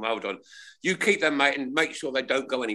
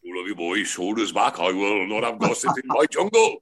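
Windsor Davies; 3 semitones lower than usual. Retold or imitated. Impression for the show panel, barking army sergeant. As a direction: lower barking sergeant major voice. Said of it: "Windsor Davis, are you ready?".

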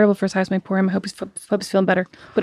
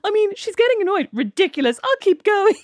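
higher, teasing imitation of the sister; mocking sister's inner voice; 8 semitones higher than usual. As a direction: play her smug inner voice. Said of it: "You're literally like".

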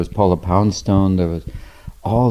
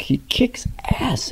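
whisper; 13 semitones higher, 8 dB quieter, slower than usual. Real, not acted. Excited praise.

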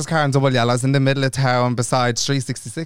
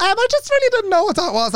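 much higher, embarrassed excuse; high sheepish excuse. Retold or imitated. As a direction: squeak it out sheepishly. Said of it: "I was like".

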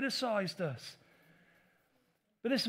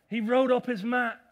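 loud emphatic; 8 dB louder than usual. Acted. Loud repeat, then plain take.

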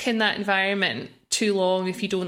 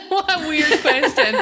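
laughing; 9 semitones higher, 5 dB louder than usual. Real, not acted. Laughing at an odd question.

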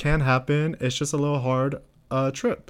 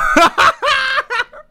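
very high, loud burst of laughter; shrieking laugh; 7 dB louder than usual. Real, not acted. Admitting she wants to end the call, shrieking laugh. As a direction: crack up into a squeal.

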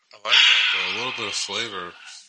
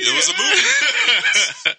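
high, slow laughing emphasis; 3 dB louder, much slower than usual. Real, not acted. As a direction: stretch it out through laughter. Hyping the album, laughing.

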